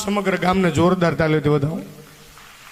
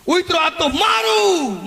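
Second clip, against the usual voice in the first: retold shout; 9 semitones higher, 3 dB louder, much slower than usual.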